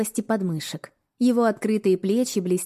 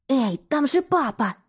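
hissed warning; 5 semitones higher than usual. Acted. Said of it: "прошипела я".

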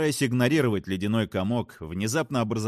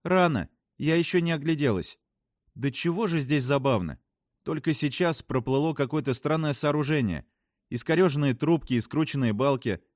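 higher, eager report of strange sights; fascinated astronaut; 6 semitones higher than usual. Acted. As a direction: describe it with wonder, pitched up. Voices a story character. (увлечённая астронавтка, высоким голосом)

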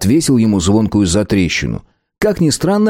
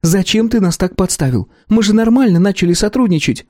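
higher, exasperated woman's voice; indignant female character; 11 semitones higher than usual. A narrator's reading. Clan leader Belka scolding the treacherous shopkeeper, higher.

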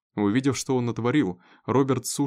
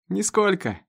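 put-on high, bright-spirited boy's reply; cheerful; 9 semitones higher, 3 dB louder, slower than usual. A narrator's reading. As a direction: answer merrily, pitch leaping up. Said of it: "весело ответил Роберт". (весело, задорно)

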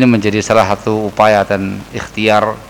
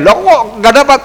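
high mock protest, only a little louder; half-hearted yelling complainer; mock indignant shout; 12 semitones higher, 4 dB louder than usual. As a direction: imitate someone complaining they were skipped. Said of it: "kamunya teriak-teriak".

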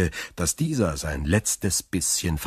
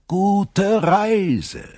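much higher, louder mock-friendly farewell call; calling after; 6 dB louder than usual. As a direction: shout sweetly after him. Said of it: "rief er Edmond hinterher".

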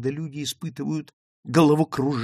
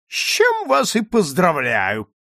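loud, slow, high jibe; pointed, ringing interjection; mock congratulations; 7 semitones higher, 7 dB louder than usual. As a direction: draw out the sarcastic congratulations. Sarcastic interjection to the son.

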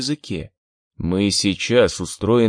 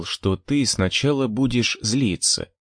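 ordinary delivery

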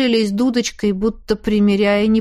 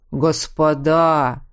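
slow drawn-out address; 5 semitones lower, much slower than usual. Acted. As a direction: stretch the word out languidly. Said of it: "протянул третий".

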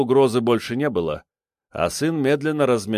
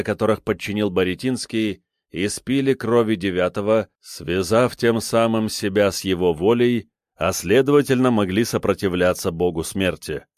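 ordinary delivery

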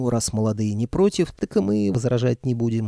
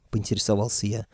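ordinary delivery

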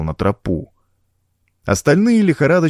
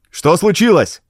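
calling out; 8 semitones higher than usual. A narrator's reading. Garston shouts down to his men, crying out. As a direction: cry out the question. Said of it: "крикнул Гарстон".

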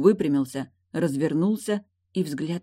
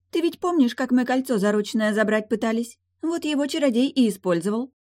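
high-pitched mermaid, reminding the listener; chatty mermaid; 8 semitones higher, 4 dB louder than usual. Acted. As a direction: tell it in a high character voice. Voices the mermaid.

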